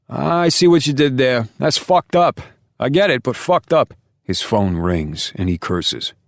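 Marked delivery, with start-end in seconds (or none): 0.1-3.9 big, high, loud New York Italian directions; New York Italian accent. Acted. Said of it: "thick New York Italian accent".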